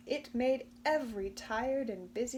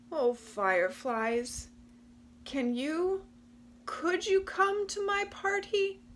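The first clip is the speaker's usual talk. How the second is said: hopeful plea; 9 semitones higher, 6 dB louder than usual. Acted. Possum pleading with the fireflies, hesitant and hopeful. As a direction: ask shyly, hardly daring to hope.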